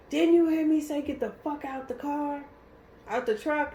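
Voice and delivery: high-pitched retold order; angry officer, retold. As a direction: imitate a cop snapping at a driver. Retold police officer confronting her.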